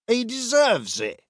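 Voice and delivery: high, indignant verdict; protesting soldier. A narrator's reading. Redheaded soldier justifying a beating, high and indignant.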